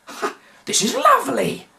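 very high, bright chirp of glee; delighted as if at a party; cheerful delight. A narrator's reading. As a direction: professor beaming at the pirates. McMoo beams cheerfully.

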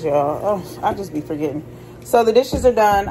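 usual speaking voice

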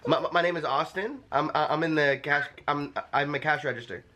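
ordinary delivery

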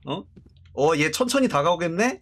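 ordinary delivery

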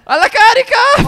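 loud, high, drawn-out charge yell; battle cry. Pretend. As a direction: shout the charge like a hammed-up warrior. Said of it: "Urlando pure". (grido di battaglia esagerato)

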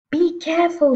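high, loud warning; shrieked. A narrator's reading. Mother warning her husband about spilled powder, shrieked. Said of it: "shrieked the mother".

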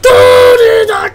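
loud whiny rage; raging gamer. Real, not acted. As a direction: complain loudly at the game.

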